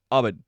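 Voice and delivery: "Ubud" as AH-bud, falling; trying a pronunciation. Shown as a demonstration. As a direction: try "Ubud" with an AH start and a falling end. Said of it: "How do you say Ubud?".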